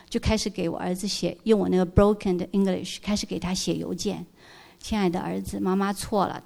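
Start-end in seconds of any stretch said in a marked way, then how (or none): 4.8-6.4 轻声道歉. Retold or imitated. Reads apology email to son.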